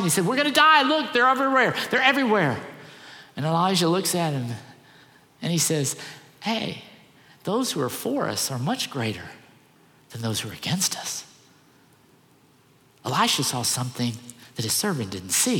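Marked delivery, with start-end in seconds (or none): 0.3-2.6 a panicked servant shouting that they're all about to die. Retold or imitated. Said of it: "he's all panicked". 6.4-11.2 soft, quiet and steady, settling his fear; calm reassurance. Retold or imitated. Elisha answers his frightened servant, soft calm reassurance.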